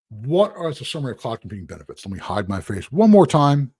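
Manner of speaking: normal talking voice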